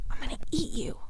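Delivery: playful whispered threat; mock menacing whisper. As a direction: whisper a silly threat to the balloon. Threatening the balloons jokingly.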